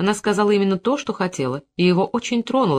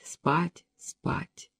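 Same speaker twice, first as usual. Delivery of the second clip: each спать softer until the second спать is breathed without voice; fading to a whisper; 2 semitones lower, 9 dB quieter than usual. A narrator's reading. Drowsy Eve drifting off, whispering спать softer and softer. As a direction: let спать, спать fade into sleep. Repeated word fading to sleep.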